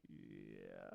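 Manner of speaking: low, soft, deadpan yeah; low muted yeah. Retold or imitated. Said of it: "I was like".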